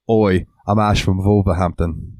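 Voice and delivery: lightly imitating a British Wolverhampton accent, slow; a mild mock Midlands British "Oi". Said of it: "said it so British".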